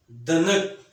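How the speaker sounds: दनक with a full, heavy, loud द; heavy letter sound. Shown as a demonstration. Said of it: "भारी आवाज़ वाले हरूफ". (भारी आवाज़ में दनक)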